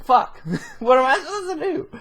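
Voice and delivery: high-pitched helpless complaint through laughter; laughing mock exasperation. Superman standing in the blown-up hearing, mock helpless, laughing. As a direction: play a hapless hero, cracking up.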